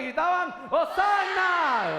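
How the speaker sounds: very high, long-held shout of Hosana; full shout. Shown as a demonstration. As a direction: bellow it to rouse the congregation. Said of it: "Grita otra vez".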